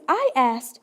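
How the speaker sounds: ordinary talk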